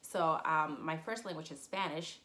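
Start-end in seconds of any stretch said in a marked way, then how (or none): none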